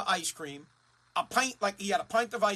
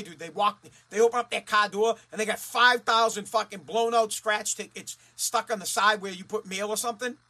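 ordinary talk, nothing marked

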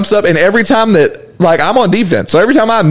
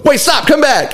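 higher-pitched urgent halt, imitated; lightly voiced coach; 6 semitones higher than usual. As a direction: mildly mimic a coach calling a runner back. Coach halts running player.

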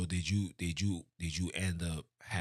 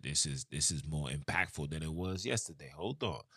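usual speaking voice

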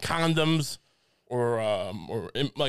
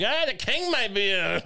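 raised, lightly acted tavern drunk; a hint of a cut-off bar patron, pitched up; medieval barfly; 7 semitones higher than usual. Barfly in the Middle Ages cut off at the bar, lightly acted protest. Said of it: "I'm the guy who's in the bar who's getting cut off".

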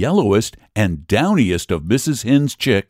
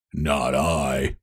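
much lower, much softer grunted no; pig with a grunt; 8 semitones lower, 7 dB quieter than usual. Acted. Pig grunts his refusal to the hen, low and soft. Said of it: "with a grunt".

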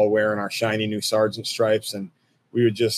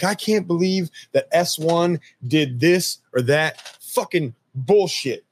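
higher, griping first sergeant rant; cussing first sergeant; 6 semitones higher than usual. Retold or imitated. First sergeant cussing about the S one. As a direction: gripe like an angry first sergeant. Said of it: "he's cussing about something".

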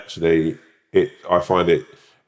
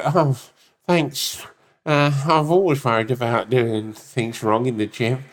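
high, meek, overly grateful gym newbie; 4 semitones higher, slower than usual. Retold or imitated. A gym newbie thanking the stranger, meek and high. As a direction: play it timid and thankful, pitch up. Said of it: "and you go".

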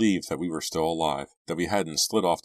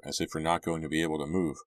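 usual speaking voice

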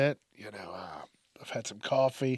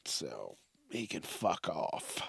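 dismissive; 9 semitones lower, 13 dB quieter, slower than usual. Real, not acted. Dismissive send-off.

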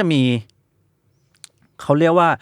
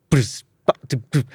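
beatboxed drum pattern. Shown as a demonstration. Podcaster assembling samples into a song, beatboxed groove. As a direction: mouth a little drum groove. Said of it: "ด้วยเทมโปเท่านี้".

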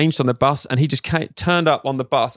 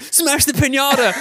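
retold shout; 11 semitones higher, slower than usual. Shouting on stage.

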